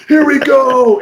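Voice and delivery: high, shouted kickoff; excited showman's cry; American hype. Acted. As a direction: launch the show like a brash American magician. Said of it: "the American approach is".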